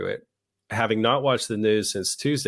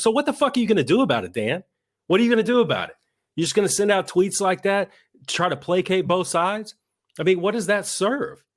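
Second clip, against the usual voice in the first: higher and fast, exasperated; heated rant; 9 semitones higher than usual. Real, not acted. Host berating a congressman's weak tweet, in a heated higher rant. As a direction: fire the questions at him. Ranting at Dan Crenshaw.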